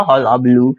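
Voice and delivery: हल्ला बलू as one word with a long held oo; pronunciation demo. Shown as a demonstration. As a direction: show how to read हल्ला बलू, stretching the end. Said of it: "ऐसे पढ़ो".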